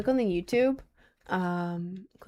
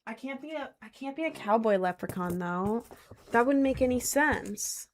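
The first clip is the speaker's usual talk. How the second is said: ordinary talk, nothing marked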